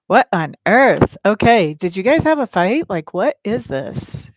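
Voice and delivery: loud, slow what on earth; shocked. Real, not acted. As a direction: react to a shocking card. Shocked by the cards.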